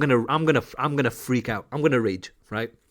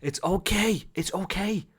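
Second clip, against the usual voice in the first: soft, hushed comforting voice; gently stroke him with the words; acted soothing; 8 dB quieter than usual. Coach consoling Tyler after he was let down, softly soothing. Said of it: "I'm gonna stroke Ty".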